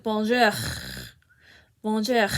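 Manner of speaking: "bonjour" ending in a harsh, throaty French R; harsher French R. Shown as a demonstration. Teacher demonstrating the growl, "bonjour" with harsher rasped R. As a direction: rasp out the final R of "bonjour" at the back of the throat. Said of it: "make it sound a little harsher".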